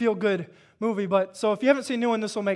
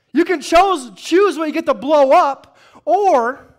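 louder, higher preacher's build; preaching at full force; 6 semitones higher, 11 dB louder than usual. Acted. Preacher firing up the congregation, loud and high. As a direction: drive the point home to the church.

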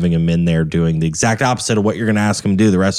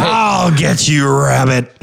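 Yosemite Sam; 5 semitones higher, much slower than usual. Pretend. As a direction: drag out a cartoon villain shout. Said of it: "sounds like Yosemite Sam".

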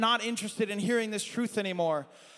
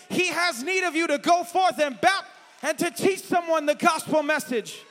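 preaching that swells to a shout; building sermon; 5 semitones higher, 4 dB louder than usual. Acted. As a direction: build it up, louder and higher. Preaching builds to full force.

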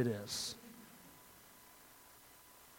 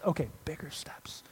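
whispering it back, much softer; whispered repeat; 9 dB quieter than usual. Retold or imitated. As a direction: breathe the words to yourself, a little fed up. Walker repeating under breath.

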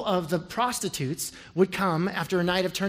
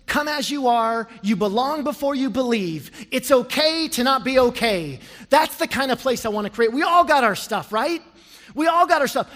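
building sermon, higher and louder; full-force preaching; 5 semitones higher, 6 dB louder than usual. Acted. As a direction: preach it out to the whole congregation.

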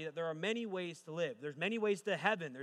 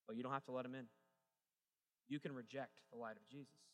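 normal talking voice

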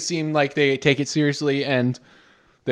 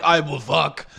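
lightly voiced dumb caveman, laughing through it; slower than usual. Friends riffing on a horny caveman, mock caveman grunt. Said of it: "in character as the caveman".

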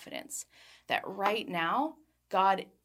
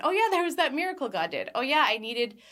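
higher, acted-out moment of remembering; mock lightbulb moment, pitched up; sudden happy recollection; 6 semitones higher, 4 dB louder than usual. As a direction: voice lifts as if it just came back to you. Acts out remembering past miracles.

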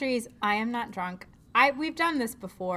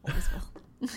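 whisper; 3 semitones lower, 4 dB quieter than usual. Pretend. Tender mock declaration.

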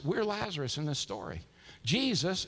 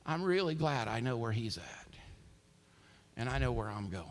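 low, softened, solemn delivery; quiet assurance; 6 semitones lower, 5 dB quieter than usual. Retold or imitated. Grieving man on the phone to his pastor, quiet assurance. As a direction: settle down into quiet, tender certainty. Grieving brother's last words, retold.

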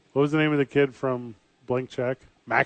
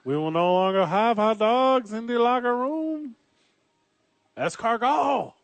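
high, hammed-up French manager voice; mock French accent; 9 semitones higher than usual. Playing a French manager for a cohost, mock French accent. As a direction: play a snooty French manager banning hot dogs.

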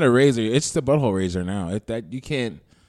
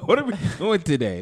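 laughing; 7 semitones higher than usual. Real, not acted. Laughing at partner's joke.

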